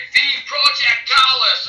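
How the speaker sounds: loud, high, drawn-out battle incantation; spell incantation. Pretend. Player casting magic missiles at the archer, loud incantation. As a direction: cast it with a slow, ringing yell. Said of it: "And say".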